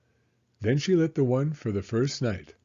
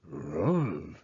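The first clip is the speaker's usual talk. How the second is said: bear grunt; 6 semitones lower than usual. Acted.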